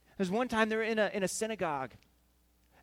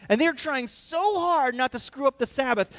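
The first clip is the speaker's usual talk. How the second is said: ordinary talk, nothing marked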